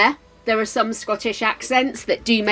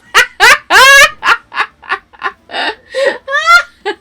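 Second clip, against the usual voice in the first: high, loud laughter near tears; helpless laugh; 15 dB louder than usual. Real, not acted. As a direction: laugh till it almost cries. Keeps laughing after the fright.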